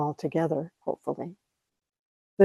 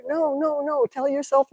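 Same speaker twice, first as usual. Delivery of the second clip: usual speaking voice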